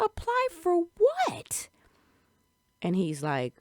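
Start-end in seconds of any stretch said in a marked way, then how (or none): 0.1-1.7 high, loud, drawn-out disbelief; mock-baffled question, slow and pitched way up; incredulous. Supposed applicant baffled by Patrick, incredulous. Acting her baffled reply to him.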